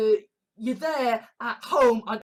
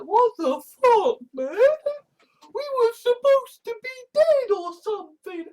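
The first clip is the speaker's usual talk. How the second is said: high, louder put-on voice of the dead; the dead complaining; 11 semitones higher, 6 dB louder than usual. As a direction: play a bewildered corpse who should be dead. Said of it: "all the dead just suddenly there going like".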